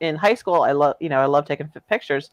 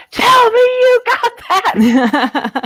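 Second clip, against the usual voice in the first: high, loud, drawn-out yell; retold yell; 5 dB louder, much slower than usual. Professor yelling across the Zodiac at the photographer, high and loud. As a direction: yell it slowly and urgently, way up high. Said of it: "my professor turns to me and yells".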